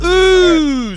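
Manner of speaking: loud, high game-show ooh; wrong-answer ooh. Acted. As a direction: sing out a sympathetic ooh at the wrong guess. Quiz host signals a wrong answer.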